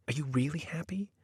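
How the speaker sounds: whispered, questioning inner voice. A narrator's reading. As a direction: whisper it like a voice in her head. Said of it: "another person saying".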